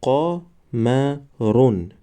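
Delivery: "قمرون" drawn out syllable by syllable; slow demo word. Shown as a demonstration. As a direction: stretch "قمرون" slowly for the class. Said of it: "دیگرش". ("قمرون" آهسته و کشیده برای شاگردان)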